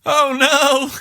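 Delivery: hammed-up screams of a man being mauled; panicked screaming. Gary screaming as Cujo bites him, high terrified shrieks.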